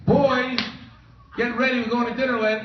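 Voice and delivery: high, quick calling to the family; a hammed-up excited call; mock gleeful call. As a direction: announce dinner in triumph. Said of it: "calling family after losing bet".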